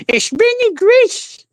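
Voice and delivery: very high, slow, squeaky cartoon mouse; mock her in a drawn-out squeal; Grace's Mickey Mouse voice. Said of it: "with your Mickey Mouse voice".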